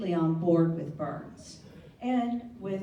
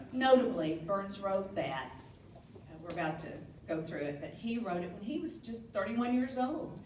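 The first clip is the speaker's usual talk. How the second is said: usual speaking voice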